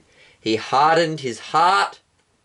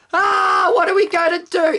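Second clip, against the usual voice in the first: panicked shriek. Acted. Egyptian screaming at a frog in her hair, high panicked squeal. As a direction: shriek like frogs are in your hair. Egyptians desperate about the frogs.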